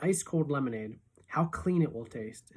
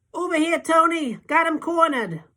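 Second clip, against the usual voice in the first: much higher, loud calling thug voice; punk calling out; 13 semitones higher, 7 dB louder than usual. A narrator's reading. Gang punk calling to Tony, their prey cornered: loud taunting call. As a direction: yell across the crowd to your boss.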